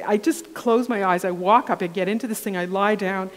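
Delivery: usual speaking voice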